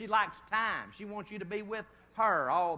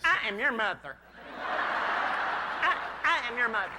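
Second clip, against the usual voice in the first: louder, higher mock mother's protest; 5 semitones higher, 5 dB louder, slower than usual. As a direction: play the shocked mother, raising it. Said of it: "She said".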